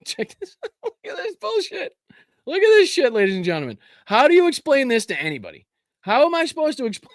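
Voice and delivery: high-pitched, cracking up through the words; laughing incredulously. Real, not acted. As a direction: squeal it out through real laughter. Cracking up at a ridiculous clip.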